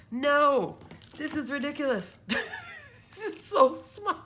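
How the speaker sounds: high, loud, laughing disbelief; real shock, cracking up. Real, not acted. Shocked at tiny die size.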